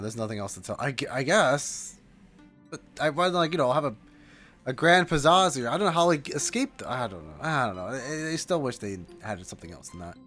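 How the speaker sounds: usual speaking voice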